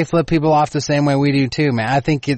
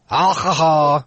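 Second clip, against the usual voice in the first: Texan accent; slower than usual. Shown as a demonstration. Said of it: "I get more Texan".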